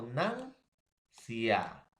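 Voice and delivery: "nancia" split, long rising "nan", falling "cia"; stress on penultimate syllable. Shown as a demonstration. Teacher explaining to students, stressed "nan" in "nancia". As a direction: lean on "nan", pause, let "cia" fall. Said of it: "la mayor fuerza de voz".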